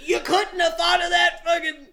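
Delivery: high, loud scoff; mock exasperation. Friend scoffs at the movie's hero. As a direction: throw it out exasperated. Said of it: "And it's like".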